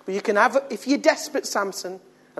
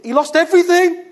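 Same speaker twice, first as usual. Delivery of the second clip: much higher, louder cry of loss; loud exclamation; 12 semitones higher, 10 dB louder than usual. Shown as a demonstration. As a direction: belt it out in despair. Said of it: "In the end, he lost everything".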